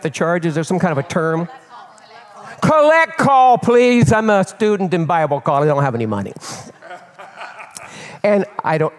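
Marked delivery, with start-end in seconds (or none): 2.6-6.3 loud, high start, falling away to usual voice; calling out a plea. Acted. Broke student begging operator for collect call, loud plea tailing down. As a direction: belt out the request, then let it sink.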